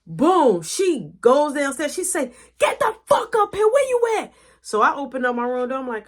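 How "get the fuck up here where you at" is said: high loud angry shout; mom yelling. Retold or imitated. Mom calling him down, shouting high and angry. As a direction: bark it up the house.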